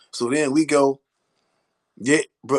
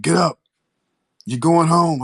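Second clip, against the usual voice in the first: 감방 문 두드리는 경관의 큰 목소리; officer's call; 6 semitones higher, 5 dB louder than usual. Retold or imitated. Officer knocking on cell.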